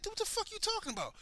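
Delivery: high, fast, baffled dude, what the fuck; incredulous retort. Retold or imitated. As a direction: shoot it back in high disbelief. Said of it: "be like".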